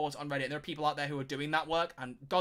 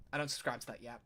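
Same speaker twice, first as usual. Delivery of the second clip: softer, under his breath, to himself; repeated more softly as a private echo; quiet repeat; 4 semitones lower, 6 dB quieter than usual. Real, not acted. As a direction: mutter it again as an afterthought.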